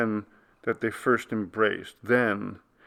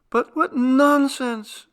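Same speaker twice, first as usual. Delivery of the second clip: high, loud, slow denial; wife crying out against the truth; 8 dB louder, slower than usual. A narrator's reading. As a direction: refuse to believe it, aloud. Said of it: "she cried".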